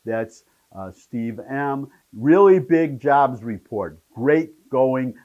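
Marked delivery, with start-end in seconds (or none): 2.1-5.1 loud, punchy cheer read from a tweet; quoted congratulations. Retold or imitated. Host reading a tweet praising the jobs report, quoted congratulations. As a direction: read it out like a proud headline.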